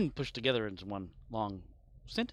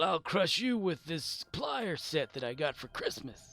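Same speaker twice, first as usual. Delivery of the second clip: hint of a mock villain, higher; mock threat; 5 semitones higher than usual. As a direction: tease the villain back. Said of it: "mocking the game line".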